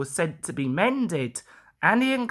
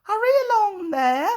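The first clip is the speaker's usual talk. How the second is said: high, fast, bossy engine call; lordly small engine; 14 semitones higher, 4 dB louder, faster than usual. A narrator's reading. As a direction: order the trucks about like a little lord. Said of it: "marshalled them in a lordly way".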